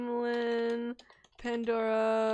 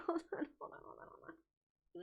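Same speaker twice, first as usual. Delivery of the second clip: trailing from soft voice into a whisper; fading to a whisper; 5 semitones higher, 14 dB quieter than usual. Real, not acted. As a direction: let it fade into a whisper. Fading to herself while scrolling.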